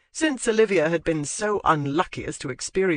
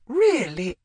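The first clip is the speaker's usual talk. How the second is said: put-on old lady voice; 6 semitones higher, 4 dB louder than usual. A narrator's reading. Lady Withcote scolding.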